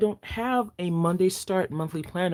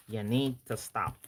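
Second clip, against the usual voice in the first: softer, lower repeat; quieter mock plea; 4 semitones lower, 6 dB quieter than usual. Real, not acted. Host pleads with Danielle, softer, mock. Teasing the planner maker.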